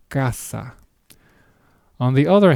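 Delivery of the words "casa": casa said carefully, C as K; hard C. Shown as a demonstration. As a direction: clear k at the start of casa. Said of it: "Once again".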